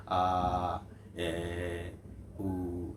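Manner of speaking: আ, এ, উ each held long and low; open vowel sounds. Shown as a demonstration. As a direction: sustain আ, এ, উ with free airflow. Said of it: "কোনো pressure টেশার নেই, একদম clear থাকবে".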